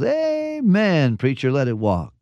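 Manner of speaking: a high jump on amen, then mild eager agreement; lightly voiced old deacon, excited. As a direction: call back like a stirred-up deacon in the pew. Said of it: "Deacon Jones got all excited".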